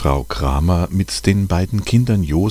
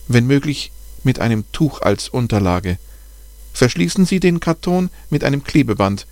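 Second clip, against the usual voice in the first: higher, careful voice of the bat expert; the expert on the phone, voiced higher, patiently explaining; kind expert's advice; 7 semitones higher than usual. A narrator's reading. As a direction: talk the caller through it step by step, kindly. Expert gives instructions on phone.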